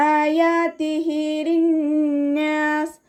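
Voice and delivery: ആയാതിഹി drawn two counts, sung slowly; tajweed recitation. Shown as a demonstration. Teacher models the verse melodically for students. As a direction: recite ലിന്നാസി with nasal ghunna, high chant. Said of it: "നൂനിന് ശ്രദ്ധ വന്നു".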